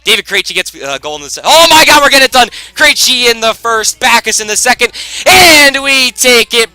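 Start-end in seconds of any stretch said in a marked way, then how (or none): 1.4-6.7 loud high burst of joy, slowing at the end; excited outburst. Real, not acted. Gamer seeing his team take the game, excited outburst. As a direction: blurt it out in delight.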